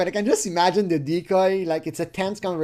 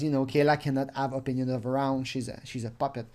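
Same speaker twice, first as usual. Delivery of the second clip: ordinary talk, nothing marked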